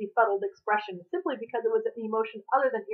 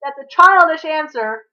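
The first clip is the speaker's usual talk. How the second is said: loud, high, slow shout; indignant loud shout; 7 semitones higher, 12 dB louder than usual. A narrator's reading. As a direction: shout it down the hall. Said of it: "Con shouted".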